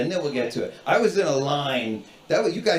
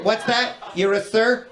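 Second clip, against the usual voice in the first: higher, taken-aback query; pitched-up, officious check; cranky gate agent; 6 semitones higher than usual. Retold or imitated. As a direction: imitate an agent caught off guard. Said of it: "these cranky airport gate agents".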